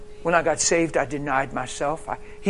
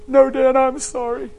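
high, loud mock-tearful plea; imitated tearful apology; cry voice; 9 semitones higher, 5 dB louder than usual. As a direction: put on a crying wife's voice. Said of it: "I won't do my cry voice".

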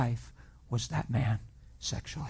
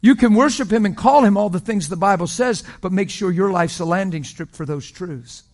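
loud, higher preaching cadence; full-force preaching; 5 semitones higher, 10 dB louder than usual. Real, not acted. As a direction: preach it hard to the crowd.

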